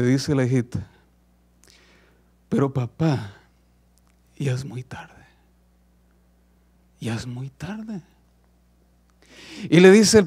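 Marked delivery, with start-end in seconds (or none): 2.5-8.0 soft, whispered, final farewell; sad whisper. Acted. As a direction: breathe it out softly, it's over. Hija se despide del padre. (susurro triste y suave de despedida)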